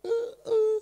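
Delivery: high, wailing child's sob, imitated; imitating a crying child. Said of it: "Anak itu tahu-tahu bilang gini". (menirukan tangis anak kecil, tinggi dan keras)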